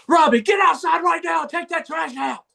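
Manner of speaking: sudden high-pitched yell of an order; mock angry dad shout. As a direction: imitate a fed-up father bellowing at his son. Retold dad suddenly yells at son.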